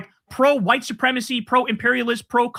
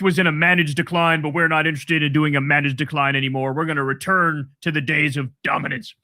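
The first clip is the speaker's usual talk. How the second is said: mock Rubio quote; 5 semitones lower than usual. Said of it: "for Rubio to say that".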